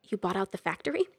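plain reading voice

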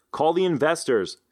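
shouted reminder across the room. Acted. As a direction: shout it after someone leaving. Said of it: "he shouted after me".

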